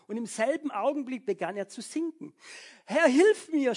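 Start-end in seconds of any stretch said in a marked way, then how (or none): 2.9-3.8 Peter sinking, yelling high and loud to the Lord; shouted cry for help. Acted. Petrus sinking in the storm, crying out to Jesus: a loud, high desperate shout. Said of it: "schrie er".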